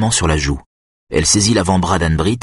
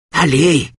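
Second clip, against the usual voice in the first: impatient, raised-pitch urging; 9 semitones higher than usual. A narrator's reading. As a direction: hurry her along impatiently. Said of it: "s'impatiente Lydia". (encouragement impatient, voix montée)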